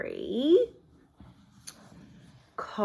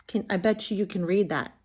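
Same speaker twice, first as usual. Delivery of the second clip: usual speaking voice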